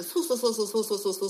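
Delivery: quick-fire Kansai-style "そうそう" run, lightly imitated; mock Kansai catchphrase. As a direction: rattle off the Kansai "そうそう" habit fast. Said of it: "いう口癖があって".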